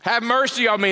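loud, high desperate cry; a blind man shouting for help. Acted. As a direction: call out across the crowd for mercy. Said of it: "He cried out".

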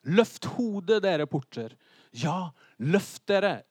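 slow, grand proclamation, opening loud; psalm declamation. Acted. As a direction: proclaim scripture with weighty, drawn-out words. (høytidelig, langsom salmelesning)

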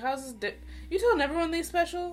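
high, fast, incredulous question; disbelieving, fast and high. Retold or imitated. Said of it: "I was like".

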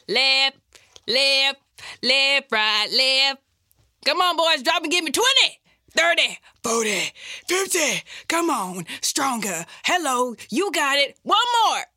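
loud high barking drill commands; drill sergeant. Pretend. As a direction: bark orders at recruits. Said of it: "Drill sergeant".

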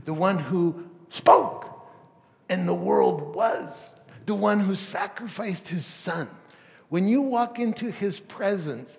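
swelling pulpit crescendo; rising preaching. Acted. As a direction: build the awe of God's throne. Preaching build to climax.

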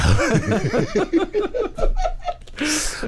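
squealing burst of laughter; helpless high-pitched laughing; long high laugh. Real, not acted. Host cracks up at joke.

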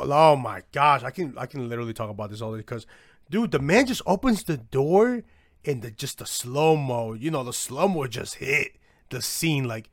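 ordinary talk

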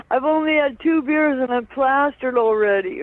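lightly acted giddy confession; excited retold boast. Brother boasting of being drunk, retold.

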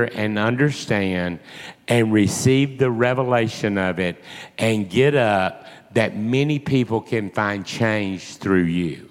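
low, slow, weighty appeal; grave, unhurried charge; solemn divine message. Retold or imitated. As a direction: deliver it low and measured, as quoted from God. Preacher voicing the Lord's message.

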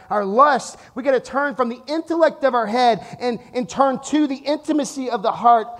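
rising, rhythmic preaching build; fervent pulpit exhortation, building. Acted. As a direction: drive each turn-from line home.

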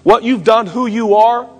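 high, loud, ringing pulpit delivery; full-force preaching. Acted. Pastor preaching to the congregation, at full force. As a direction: preach it out to the whole room.

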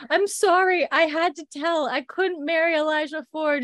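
high-pitched pleading wail; mock tearful. Young woman confessing to Isaiah, mock tearful pleading. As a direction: plead in a hammed-up weepy voice. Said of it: "And she's crying".

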